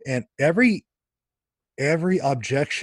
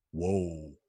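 a hushed, lower whoa of quiet amazement; awed whoa; 7 semitones lower, 9 dB quieter than usual. Retold or imitated. Recalling reading Irenaeus, a hushed awed whoa. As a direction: let the realization land softly. Awed reaction in his own head.

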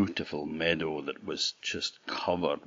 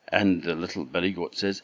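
plain reading voice

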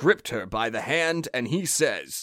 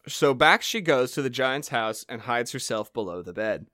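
normal talking voice

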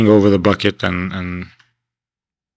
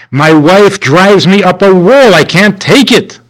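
higher, louder, slow fed-up groan; exasperated husband; 9 semitones higher, 6 dB louder, slower than usual. Retold or imitated. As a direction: vent about the wife. Husband complaining about wife.

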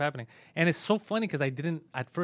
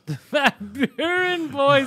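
name said through a long laugh; 11 semitones higher, 8 dB louder, much slower than usual. Real, not acted. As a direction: laugh out the words slowly.

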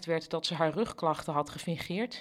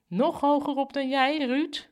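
high, needling mock question; snide; 9 semitones higher, 5 dB louder than usual. Kavia needles Ruud with a snide, higher question. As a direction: ask it with a nasty sting. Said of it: "vroeg Kavia vals".

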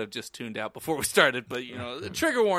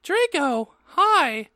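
put-on high-pitched girlish greeting; high voice falling on each word; girl's part; 13 semitones higher, much slower than usual. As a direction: greet him in a high acted girl voice. Fan fiction read aloud, girl's part.

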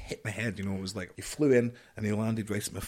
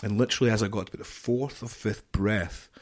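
ordinary talk, nothing marked

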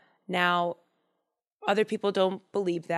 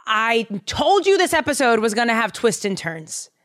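ordinary delivery

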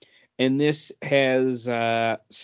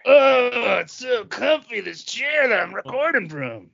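hammed-up contented sigh, higher and cooing; mock blissful; 9 semitones higher than usual. Podcaster hamming up his comfy leather chair, mock blissful. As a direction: lay it on thick like sinking into a plush chair.

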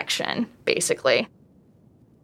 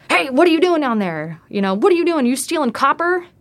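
higher, accusing local demanding answers; suspicious electrician; 7 semitones higher than usual. Retold or imitated. Electrician catches her under the boardwalk, accusing shout. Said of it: "He was like".

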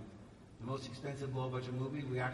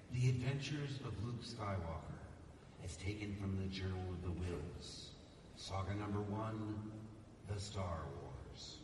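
slow, deep, solemn reading of the title, lower at the end; grand title reading; 8 semitones lower, 4 dB quieter than usual. Retold or imitated. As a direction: read it like a movie trailer. Reading the script's title page.

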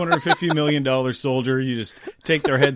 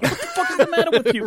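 very high, fast incredulous squawk; mock outburst; faster than usual. Colonel to a scientist, high mock outrage. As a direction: blurt it fast in comic outrage. Acted outburst, film character.

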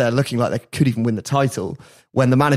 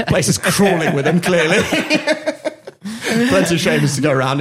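laughing; 5 semitones higher than usual. Real, not acted. Laughing at many shamans.

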